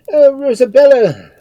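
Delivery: high, very loud cry; frantic anguished shout; screaming in despair. A narrator's reading. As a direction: scream her name in agony. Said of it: "screamed Father Morris".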